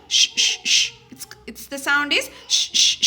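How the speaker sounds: sh said as a long hiss; teacher demonstrating the sh sound. Shown as a demonstration. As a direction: model sh, sh clearly. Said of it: "the sound a doesn't come from shoe".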